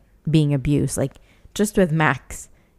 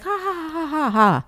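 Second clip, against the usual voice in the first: high-pitched imitated ha-ha laugh; mock laughter; 12 semitones higher than usual. Imitating the people around the recording, mock laughter. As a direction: imitate onlookers laughing, starting high and falling. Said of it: "but they were laughing".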